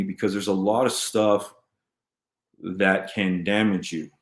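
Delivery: ordinary talk, nothing marked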